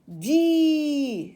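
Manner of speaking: demonstrating di as one long syllable; one-breath syllable. Shown as a demonstration. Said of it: "c'est la syllabe".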